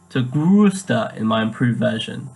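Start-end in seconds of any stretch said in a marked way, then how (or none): 0.2-1.2 "größter" with an emphasized umlaut, like "grooster". Shown as a demonstration. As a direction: round and stress the ö in "größter". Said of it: "that extra emphasis on the O".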